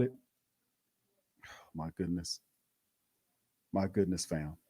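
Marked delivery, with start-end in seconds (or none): 1.4-4.6 defeated sigh. Real, not acted. Stunned after the loss.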